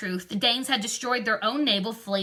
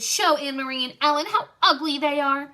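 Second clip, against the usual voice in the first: higher, louder, bossy order; commanding; 5 semitones higher, 5 dB louder than usual. A narrator's reading. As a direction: give the order like a stern little girl. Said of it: "she commanded".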